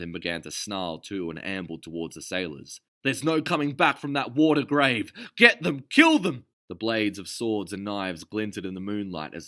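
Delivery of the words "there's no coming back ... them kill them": high, loud battle cry; first mate roaring orders at his pirates; rallying roar. A narrator's reading. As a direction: whip the crew into a killing frenzy.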